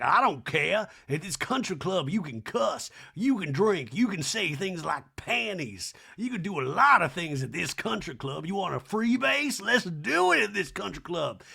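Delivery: hammed-up Southern socialite, swooping; Sutton. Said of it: "And Son's like".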